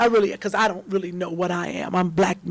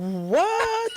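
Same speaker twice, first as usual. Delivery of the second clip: surprised what; 11 semitones higher than usual. Real, not acted. Mock surprise at chitlin circuit.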